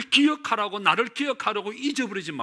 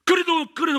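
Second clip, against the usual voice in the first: high, louder stammer on 그래도; 그래도 blurted twice, much higher and louder; flustered; 13 semitones higher, 8 dB louder than usual. Retold or imitated. Pastor catching himself before the deacon, flustered and high. Pastor backpedalling in retold dialogue.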